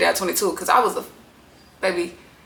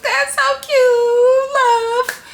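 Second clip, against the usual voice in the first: squealing aww; 3 dB louder, much slower than usual. Retold or imitated. Her own reaction to couple.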